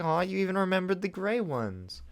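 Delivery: high, touched and delighted; touched Adora. Retold or imitated. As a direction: gush with surprised gratitude. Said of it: "It's like".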